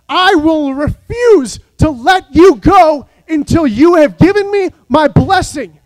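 loud, slow, fierce demand; fierce shouted demand for a blessing; gritted defiance. Acted. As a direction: grip and refuse to let go. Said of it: "he said".

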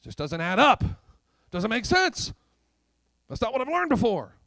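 high, loud objection; loud mock protest. Preacher voicing doubters of God's math, loud protest. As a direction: object loudly, pitch climbing.

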